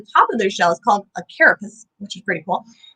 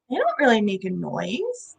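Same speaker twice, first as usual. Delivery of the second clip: normal talking voice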